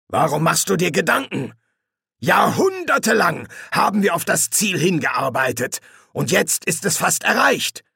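high, forceful exhortation; zealous senior demon. Acted. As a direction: proclaim the cause with fervour. Hastur exhorts Crowley.

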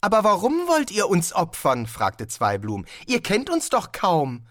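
eager tourist Zweiblum. Acted. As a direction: play the puzzled, cheerful traveller.

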